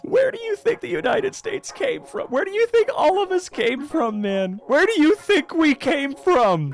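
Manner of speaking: high-pitched, incredulous rant; indignant outburst. Real, not acted. Indignant retort, repeated rhetorical question.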